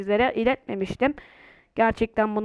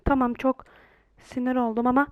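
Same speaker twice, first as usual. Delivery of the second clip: ordinary talk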